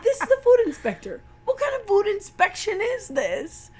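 high, loud comic dad rant; fast outraged squawk from a father; mock dad voice. Telling the movie's dad scene, high and loud outrage. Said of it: "He's like".

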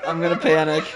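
loud, laughing; laughing loudly. Real, not acted. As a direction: say it through laughter.

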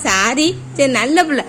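ordinary talk

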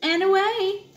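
sing-song "up, up, and away" pitched high; playful lift-off. Acted. Parent reading to kids, playful high sing-song. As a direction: launch it like a superhero takeoff.

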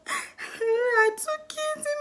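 very high, very slow squeaky reply; a pitiful little mock answer; mock tiny voice. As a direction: drag it out in a tiny mocking squeak. Her own tearful answer mocked.

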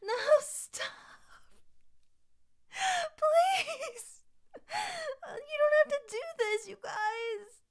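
high, near-crying whine; overwhelmed pleading. Real, not acted. Streamer begging viewers to stop gifting, overwhelmed plea. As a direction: beg them in a high wail.

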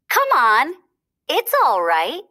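high, cheerful coaxing; bunny encouraging his friend; encouragingly. A narrator's reading. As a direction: coax a nervous friend onto ice. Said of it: "Thumper said encouragingly".

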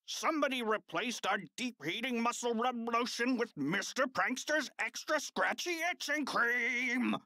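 high, put-on outraged voice; coach's shouted complaint. A narrator's reading. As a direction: cry out the prank as an indignant coach. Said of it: "shouted the coach".

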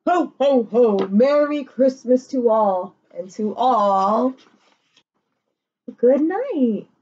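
Santa voice. Acted. Santa calling to the world from his sleigh, slow and jolly. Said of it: "He calls out to the world from his sleigh".

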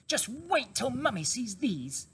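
high and eager, racing with joy; a cub picturing his mother's face; excited small fox. A narrator's reading. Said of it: "he kept thinking".

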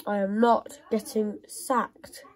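slow, each word firm, "not" hit hard; insist, leaning on "not"; stress on not. Real, not acted. Manager refusing to be sacked, slow and firm.

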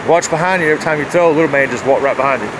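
normal talking voice

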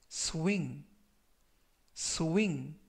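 "swing" said clearly as sw-ing, with a falling close; careful pronunciation demo. Shown as a demonstration. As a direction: model "swing" for learners. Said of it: "이라고 발음하시면 되겠고요". ("swing" 또박또박 발음 시범)

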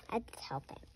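soft breathy whisper. Pretend. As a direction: whisper it like a secret. Pretend vet tending the skunk.

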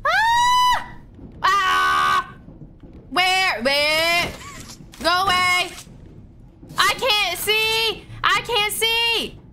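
terrified gamer yelling at the monsters; panicked screaming. Real, not acted. Hunted by monsters in game.

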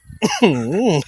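a short dry cough fit; trying not to cough on camera; real cough. Real, not acted. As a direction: cough through it, trying to stop. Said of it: "อย่าไอ อย่าไอ".

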